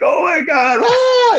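high squealing mock scream at the hookers. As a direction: shriek it out like a tripping kid. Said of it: "screaming at 'em".

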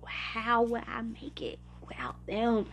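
breathy hushed whisper, slowed. Real, not acted. To herself about family, whispered. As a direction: let it drop to a whisper.

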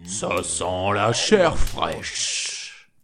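slow, booming ogre cry; ogre sniffing. Acted. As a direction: drag each word out like a hungry ogre. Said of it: "puis s'écria". (ogre affamé, lent et grondant)

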